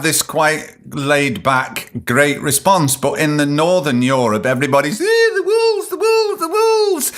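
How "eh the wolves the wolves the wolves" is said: very high, shrieking mock panic; panicked shriek. Podcaster mocking Northern Europeans' fear of wolves with a very high panicked shriek. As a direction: shriek about wolves like a terrified villager.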